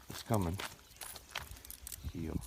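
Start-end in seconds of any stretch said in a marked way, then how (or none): 2.1-2.4 gentle, low-key heel command; a soft correction, barely raised; quiet heel cue to the dog. Real, not acted. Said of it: "See how soft that correction was?".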